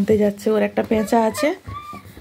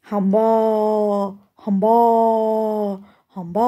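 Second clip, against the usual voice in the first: lower, long mooing hamba; cow moo; 4 semitones lower than usual. Shown as a demonstration. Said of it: "গরু কেমন করে ডাকে?". (নিচু গলায় গরুর হাম্বা ডাক)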